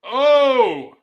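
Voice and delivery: loud surprised oh; excited shout. Real, not acted. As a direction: react loud to the move. Reacting to big move.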